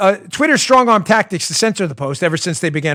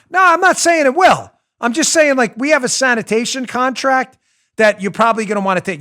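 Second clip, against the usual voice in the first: mobster voice; 7 semitones higher, 5 dB louder than usual. Retold or imitated. Mob enforcer voiced in story.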